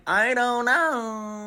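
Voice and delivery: high, loud, drawn-out mimicry; exaggerated imitation of a useless agent; mocking cluelessness. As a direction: stretch it out slowly in a high mock voice. Mocking the airline's imagined clueless answer.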